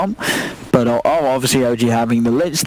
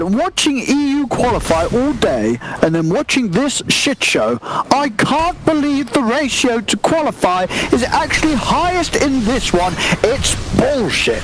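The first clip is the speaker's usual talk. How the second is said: mock posh British accent, higher and slow; 8 semitones higher, slower than usual. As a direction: read the tweet aloud in an over-the-top British accent. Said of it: "I'll say it in a very British accent".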